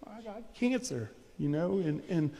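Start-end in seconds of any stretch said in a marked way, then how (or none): 0.1-1.1 higher, softer, a lightly voiced self-pitying sigh; mock complainer. Preacher mimics a believer grumbling about illness, mildly self-pitying. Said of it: "it's easy to say".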